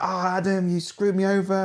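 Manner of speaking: mock-complain like a friend who was cheated; imitating the chef. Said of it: "And he's like".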